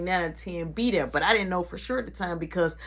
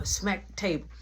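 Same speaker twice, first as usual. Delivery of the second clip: usual speaking voice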